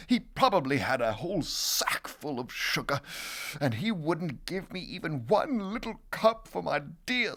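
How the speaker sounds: plain reading voice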